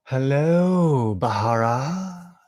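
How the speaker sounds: high, slow, softening to a hush; sweet drawn-out hello, then a soft I love you; tender greeting. Real, not acted.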